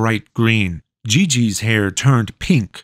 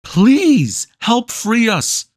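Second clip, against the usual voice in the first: high, strained plea while struggling; a trapped boy begging the witch while straining; 13 semitones higher, slower than usual. A narrator's reading. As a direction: grunt it out against the roots. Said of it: "Lucas grunted as he struggled".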